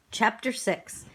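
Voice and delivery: usual speaking voice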